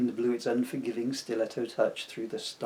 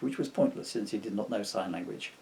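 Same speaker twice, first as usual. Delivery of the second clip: ordinary talk, nothing marked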